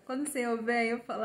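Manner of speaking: ordinary talk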